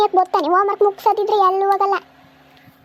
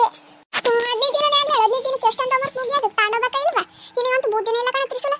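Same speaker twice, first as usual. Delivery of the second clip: put-on high, fast, childish nagging voice; scolding kid; 6 semitones higher than usual. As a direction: play a bossy little kid telling off her friend.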